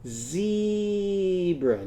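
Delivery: zebra said slowly with a drawn-out vowel; sounded-out zebra. Shown as a demonstration. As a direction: stretch the word so kids hear each part. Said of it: "let's say zebra and see if you can find the rhyme".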